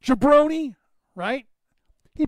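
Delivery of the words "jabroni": slow, much higher and louder wrestler's taunt; The Rock impression. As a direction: sneer it like a wrestling heel calling someone out. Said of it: "when he's playing that character".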